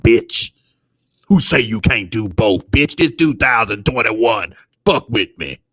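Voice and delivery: low swaggering impression of a fighter; mock Boom impression. Impersonating Boom twerking.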